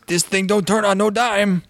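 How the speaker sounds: quoting a Cajun boat captain; light Cajun twang on the quote; Cajun accent. Retold or imitated. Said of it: "all I remember him saying is".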